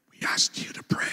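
hushed whisper in his ear. Retold or imitated. Lady behind him whispering a reminder. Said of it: "and I hear".

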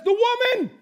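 loud, high protest of blame; mock blame-shifting. Adam answers God by blaming Eve, loud mock protest.

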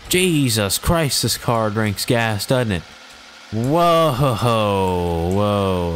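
high, loud alarmed yelps as the car lurches; alarmed exclaiming. Real, not acted. Gamer yelling as his car speeds out of control, alarmed. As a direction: panic as the car races off.

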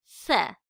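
tongue between the teeth for the s; lisped s. Shown as a demonstration. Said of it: "Buradaki ise peltektir".

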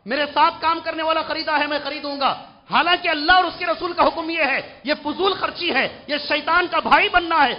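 forceful warning. Acted. Preacher warning wasteful people from scripture, forceful warning. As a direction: thunder the warning at the congregation. Preaches against needless spending.

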